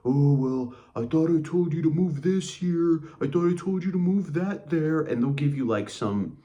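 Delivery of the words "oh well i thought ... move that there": high, louder mock-boss scolding; nagging boss. Boss in the workplace correcting you, mocking nagging voice. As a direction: imitate a fussy boss complaining. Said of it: "a boss in the workplace that's like".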